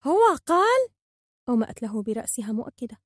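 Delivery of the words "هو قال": very high, loud question; incredulous high bird voice; astonished disbelief. A narrator's reading. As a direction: blurt it in amazement. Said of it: "بدهشة وعدم تصديق قال".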